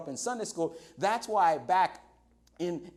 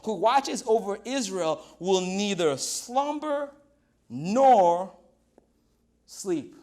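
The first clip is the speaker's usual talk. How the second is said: stretched reading with a swell on nor; slow scripture reading; 5 dB louder, slower than usual. Acted. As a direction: linger over each word of the verse. Pastor reads psalm verse slowly.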